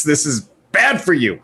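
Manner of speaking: normal talking voice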